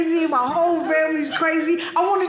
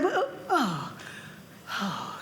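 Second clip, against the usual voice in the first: overwhelmed gasp; 7 semitones lower, 11 dB quieter, much slower than usual. Retold or imitated. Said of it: "he's like".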